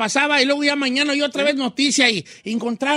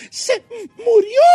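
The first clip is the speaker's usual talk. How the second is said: tearful; 9 semitones higher, slower than usual. Retold or imitated. Retelling crying over Maradona.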